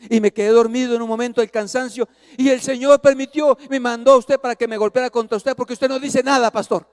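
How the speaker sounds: high, loud outpouring from exhausted driver; distressed pleading. Retold or imitated. Hermano angustiado se disculpa ante pastor. (súplica angustiada, voz aguda)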